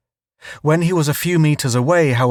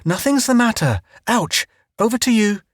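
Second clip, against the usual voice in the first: high, strained false calm; faked calm; 10 semitones higher than usual. A narrator's reading. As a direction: hide the pain on radio. Hit by stone, faking calm.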